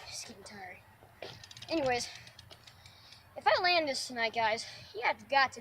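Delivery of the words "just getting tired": tired, whispered aside; barely voiced, worn out; breathy whisper. Real, not acted. Exhausted after failed attempts.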